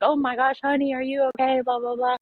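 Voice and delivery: high, fussing motherly voice; a concerned neighbor calling honey; worried neighbor lady. Retold or imitated. Lady rushes out to help.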